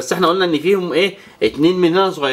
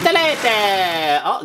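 drawn-out count; much slower than usual. Acted. Race countdown with toy planes.

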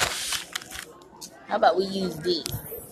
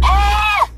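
startled yelp; 10 semitones higher, 5 dB louder than usual. Real, not acted. Jumps a curb while driving.

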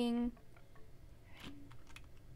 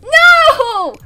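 shout; 13 semitones higher, 15 dB louder than usual. Real, not acted. Panicking in game.

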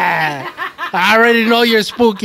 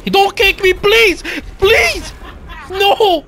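panicked pleading; 12 semitones higher, much slower than usual. Real, not acted. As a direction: squeal for mercy as it hits you. Being kicked by game monster.

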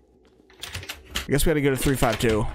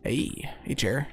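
whispering; 7 dB quieter, slower than usual. Real, not acted. Startled whisper at moving chair.

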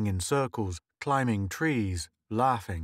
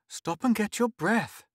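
calming mother; 11 semitones higher than usual. Acted. Mother calms breathless child.

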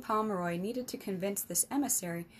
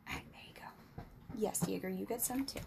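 whispering; 7 dB quieter than usual. Real, not acted. Whispering aside to pets.

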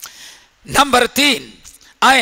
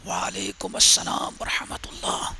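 unvoiced whisper, lower; 4 semitones lower, 12 dB quieter than usual. Companion secretly whispering his reply to the Prophet. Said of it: "چپکے سے".

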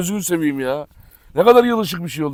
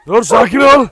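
firm call; 4 semitones higher, 7 dB louder than usual. Real, not acted. Calming agitated dog loudly.

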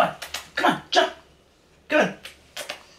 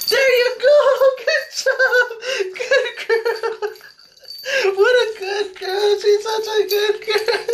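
bright, loud, laughing praise; excited praise; 3 semitones higher, 3 dB louder than usual. Real, not acted. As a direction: cheer the dog on, laughing. Dog jumps, praise.

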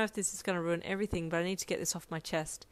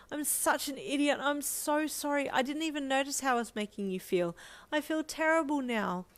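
high, pleading, heartfelt apology; compassionate remorse; 7 semitones higher than usual. A narrator's reading. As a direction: apologise as if it pains you. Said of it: "The compassion in his voice".

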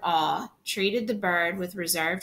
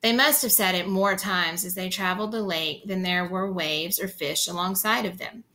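ordinary delivery, nothing marked

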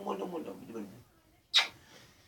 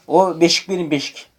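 loud mock claim; 3 semitones higher, 7 dB louder than usual. Loud childish claim over cradle.

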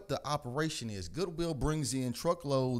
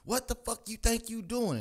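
mock angry yell; 11 semitones higher than usual. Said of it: "she starts fucking screaming at me".

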